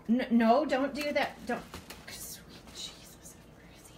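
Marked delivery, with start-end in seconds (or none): 2.1-3.9 slow voiceless whisper; whispered plea. Real, not acted. Woman dreading the mouse, whispering for mercy. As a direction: whisper a prayer under the breath. Praying under breath.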